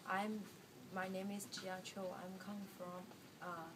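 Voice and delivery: ordinary talk, nothing marked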